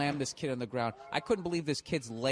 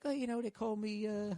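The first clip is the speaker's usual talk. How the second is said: higher-pitched mock Australian kid; imitating the bullied Aussie kid, higher; hammed-up Australian kid accent; bad Aussie accent; 8 semitones higher, 4 dB quieter than usual. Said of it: "brutal fucking Aussie accent".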